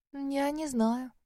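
slow, high, let-down reply; upset. Acted. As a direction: sound sorry and let down. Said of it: "огорчённо ответила Синтия".

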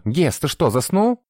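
high, impatient inquisitor; high-pitched rebuke to a dawdler; scolding call. A narrator's reading. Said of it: "прикрикнул со своего места инквизитор". (прикрикнув, высоким голосом)

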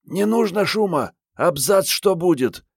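high-pitched female character voice; urgent girl's warning. A narrator's reading. Marietta urgently warns Andrey off shooting.

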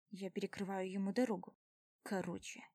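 plain reading voice

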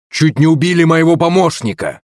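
high-pitched outraged accusation; furious. A narrator's reading. Prince accuses family over his assistant, furious. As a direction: hurl the accusation at them. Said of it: "яростный голос Демидова".

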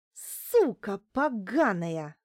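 slow, loud, savage insult; furious yell. Acted. Larisa yells in the doctor's face in fury, slow and loud. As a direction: spit each word out in rage. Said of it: "выкрикнула ей в лицо Неделькина, свирепея".

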